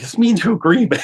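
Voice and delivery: high, starstruck gush; awed fan blurting out a name. Retold or imitated. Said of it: "I'm like".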